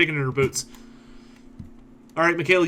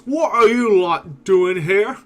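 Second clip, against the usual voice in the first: slow, loud ogre growl, pitched up; 6 semitones higher, 6 dB louder, much slower than usual. Acted. Ogre road guard challenging the party, slow and loud. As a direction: loom over them and drawl the question slowly. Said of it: "and says".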